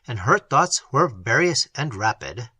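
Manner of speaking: usual speaking voice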